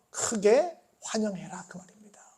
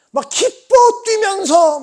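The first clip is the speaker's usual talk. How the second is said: high, loud, drawn-out elation; exuberant; 11 semitones higher, 10 dB louder, slower than usual. Acted. Preacher acts out joyful dancing worship, exuberant. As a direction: act out jumping for joy, stretching the words.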